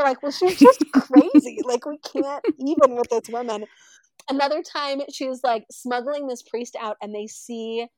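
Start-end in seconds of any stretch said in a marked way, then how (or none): 0.2-3.7 high, loud, fed-up verdict; exasperated. Retold or imitated. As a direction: give up on her completely. Judges giving up on her.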